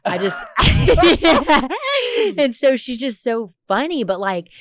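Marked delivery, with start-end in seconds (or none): 0.4-2.3 high, loud laughing fit; real delighted laugh; burst of laughter. Real, not acted. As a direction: crack up helplessly. Reacting to daughter's "Yeah.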